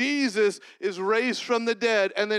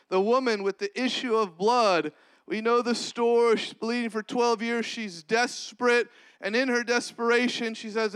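ordinary delivery

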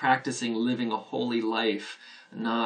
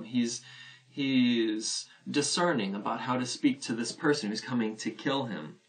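normal talking voice

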